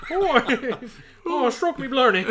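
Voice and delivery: high, mock Irish brogue; hammed-up fake Irish voice; bad Irish accent. Mocking an Irish podcaster in a bad Irish accent. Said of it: "I can't do an Irish accent".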